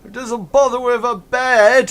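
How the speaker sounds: high, very loud heckle; shouting. Acted. Ruffian topping his mates' joke, high full shout. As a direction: shout down the others' guess. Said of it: "somebody else shouts".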